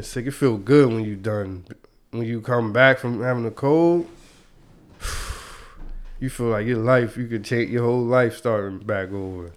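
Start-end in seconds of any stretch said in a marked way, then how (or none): 5.0-6.1 long breathy sigh of relief; tired sigh. Real, not acted. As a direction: let out a weary breath. Recovering from a cold, tired.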